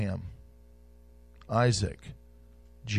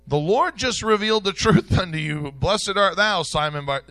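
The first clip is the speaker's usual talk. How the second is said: loud, high preaching with a laugh; fervent preaching; 4 semitones higher, 6 dB louder than usual. Real, not acted. Preacher contrasts Peter blessed and rebuked, loud and high. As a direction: hammer the contrast home.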